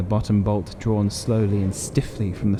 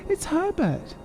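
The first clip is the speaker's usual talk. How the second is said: much higher frantic mother's cry; desperate cry. A narrator's reading. Mother struggling with husband, desperate high cry. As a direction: cry out for the lost son. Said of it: "she cried".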